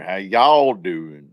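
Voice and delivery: slow drawn-out Southern y'all; Southern twang. Shown as a demonstration. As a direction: stretch it with a Southern drawl. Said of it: "you put a Southern twang on there".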